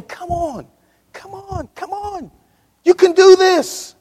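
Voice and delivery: high, pleading coax building to loud; God coaxing a straying child. Pretend. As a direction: call them in gently, then urge.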